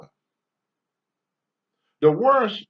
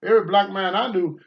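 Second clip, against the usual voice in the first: ordinary delivery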